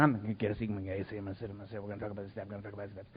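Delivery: low, very soft nervous self-talk; anxious inner muttering. Retold or imitated. Newcomer talking himself into a seat, low nervous murmur. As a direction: mumble to yourself, trying to stay calm.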